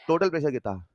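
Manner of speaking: ordinary talk, nothing marked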